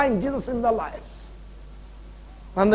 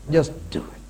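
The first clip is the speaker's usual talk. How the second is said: whisper; 5 semitones lower than usual. Real, not acted. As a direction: breathe it out as a private urging. Whispered exhortation to submit.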